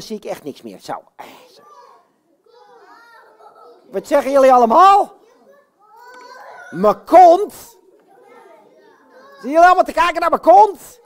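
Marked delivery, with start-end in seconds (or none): 3.9-11.0 loud, high playful outcry; mock indignant call. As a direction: call back at the children in mock outrage. Pop roept verontwaardigd naar kinderen. (quasi-verontwaardigd roepen)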